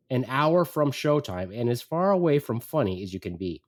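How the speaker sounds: ordinary talk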